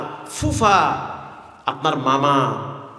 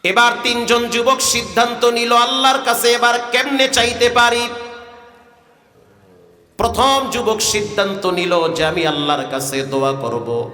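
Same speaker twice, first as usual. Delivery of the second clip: sermon storytelling; 3 semitones higher than usual. A narrator's reading. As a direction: tell the hadith in a lilting preacher's chant. Preacher chants the hadith story. (সুরেলা ওয়াজের ভঙ্গিতে কাহিনি বলা)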